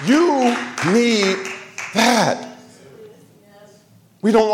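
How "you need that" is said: high, stirring sermon cadence; preaching. Acted. Preacher urging the church to see suffering's purpose, fervent. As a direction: lift the congregation with rising preaching.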